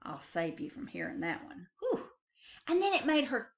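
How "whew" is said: ordinary delivery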